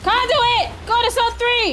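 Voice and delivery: urgent callout. Real, not acted. Streamer shouting orders at teammates, loud urgent callout. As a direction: yell it across the team so they move.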